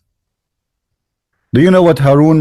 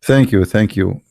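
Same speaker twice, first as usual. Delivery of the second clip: usual speaking voice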